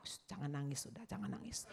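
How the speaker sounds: a teacher gently shushing a pupil; comforting a crying pupil during a test, softly hushed; soothing teacher. Retold or imitated. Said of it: "Gurunya kasih tahu".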